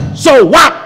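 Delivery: loud high scoffing "So what?"; imitating an arrogant politician slamming the table, loud and high; mock defiant shout. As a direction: sneer it out like a rowdy debater banging the table. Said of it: "Tak boleh tepuk-tepuk meja".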